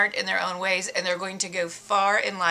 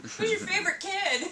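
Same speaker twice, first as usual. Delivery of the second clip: laughing; 11 semitones higher, 4 dB quieter than usual. Real, not acted. Laughing mock question.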